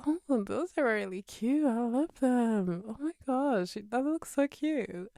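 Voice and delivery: put-on retelling a fan-girl compliment to a stranger, high and gushing. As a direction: coo over the cute jeans. Gushing over a stranger's jeans.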